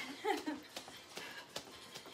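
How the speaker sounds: soft, airy relieved laugh; breathless laugh. Real, not acted. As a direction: laugh out the effort. Laugh after finishing round.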